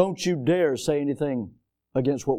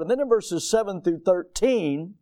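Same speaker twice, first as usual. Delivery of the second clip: normal talking voice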